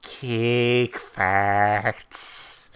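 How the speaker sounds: slow mock Minnesota accent, vowels stretched. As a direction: imitate a Minnesotan woman's drawn-out speech. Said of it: "it's that Massachusetts accent".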